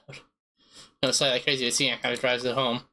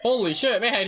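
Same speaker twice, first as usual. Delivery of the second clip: surprised; 9 semitones higher, 3 dB louder, slower than usual. Real, not acted. Startled by creepy game voice.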